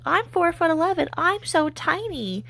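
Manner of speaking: high-pitched mock tiny-girl voice; mocking imitation. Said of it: "She's like".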